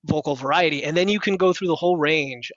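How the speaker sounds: ordinary talk, nothing marked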